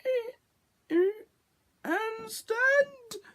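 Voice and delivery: high squeaky mimicked noises; comic high-pitched sound effect; imitated robot spikes. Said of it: "two spikes that go".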